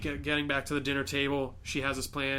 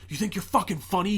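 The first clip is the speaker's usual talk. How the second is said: higher, louder menacing challenge; threatening; 6 semitones higher, 4 dB louder than usual. Retold or imitated. Host slamming the table at the guest, menacing. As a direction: confront him hard. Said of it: "he slams the table, and he's like".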